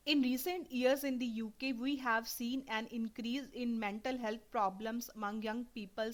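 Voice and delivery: slow, deliberate test reading, every word clear; careful read aloud. Shown as a demonstration. Teacher reading a PTE passage to students, slow and clear. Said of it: "मैं यहाँ पे mental को skip कर रही हूँ".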